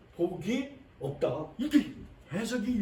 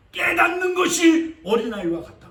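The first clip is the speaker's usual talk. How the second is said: higher, louder proclaiming; preaching shout; 8 semitones higher, 7 dB louder than usual. Real, not acted. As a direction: call it out to the congregation.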